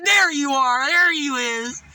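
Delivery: high loud teasing greeting; mock cheery shout. Narrator taunting the beggar, high loud shout. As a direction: belt it high like a jeer. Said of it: "I said".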